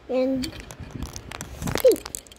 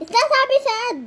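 insistent correction; 10 semitones higher, 4 dB louder than usual. Real, not acted.

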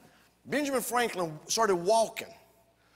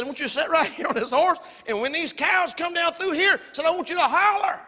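high, raised-voice orders; loud cowboy instructions; 9 semitones higher, 7 dB louder than usual. Acted. Cowboys tell the boy on horseback to holler, loudly. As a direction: call it out to the new hand.

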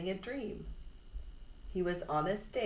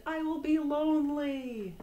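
wailing shout; 9 semitones higher, 4 dB louder than usual. A narrator's reading. Toad spinning in the dark, wailing for Frog. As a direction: wail it out after your friend. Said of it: "he shouted".

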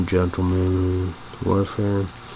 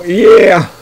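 excited yeah; 9 semitones higher, 9 dB louder than usual. Real, not acted. Approving a movie.

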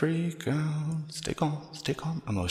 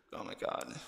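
ordinary talk

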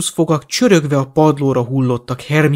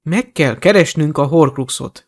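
ordinary delivery

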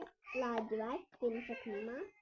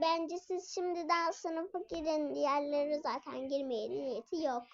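ordinary talk

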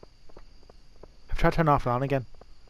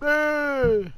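high sharp Hey!; caught you; 13 semitones higher, 3 dB louder than usual. Acted. Catching someone at the bins, a high sharp call.

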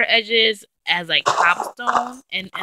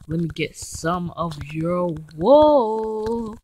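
drawn-out coaxing; much slower than usual. Real, not acted.

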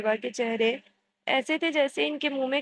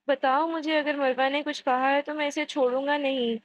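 ordinary delivery, nothing marked

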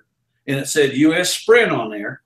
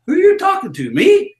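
puzzled who me; 12 semitones higher, 4 dB louder than usual. Retold or imitated. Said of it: "they'd look beside 'em like".

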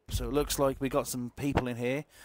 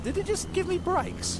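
slow, much higher disbelieving question; incredulous high squeak. Real, not acted. Car suddenly braked.

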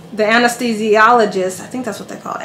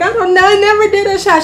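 squeaky, much higher protest; high whiny protest; 11 semitones higher, 4 dB louder, faster than usual. Retold or imitated. Patient to the anesthesiologist, high whiny protest. Her own retold protest.

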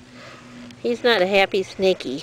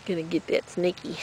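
voicing the cat; 4 semitones lower, 9 dB quieter than usual. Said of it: "He's like".

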